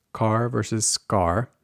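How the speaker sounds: Car with a puff, scar without; aspiration contrast. Shown as a demonstration. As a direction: slowly set airy Car against unaspirated scar. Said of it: "not nearly as aspirated".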